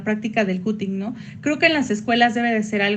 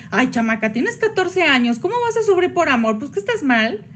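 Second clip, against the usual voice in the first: dismissive scolding; 4 semitones higher than usual. Pretend. Dismissive adult scolding teen.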